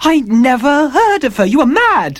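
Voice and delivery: very high, loud desperate denial; panicked denial. Acted. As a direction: plead for your life. Dorian denies in panic.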